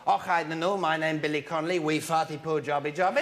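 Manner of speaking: low Billy Connolly-style Scottish send-up; mock Scottish accent. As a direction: put on a comic Scottish brogue. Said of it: "they all speak in Scottish".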